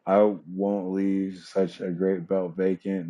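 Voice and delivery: usual speaking voice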